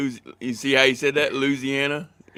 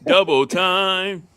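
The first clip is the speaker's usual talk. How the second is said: slow, raised, stretched-out words; drawn-out call; 7 semitones higher, 4 dB louder, much slower than usual. Acted. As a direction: stretch each word out, pitched up. Playfully echoes a slang phrase.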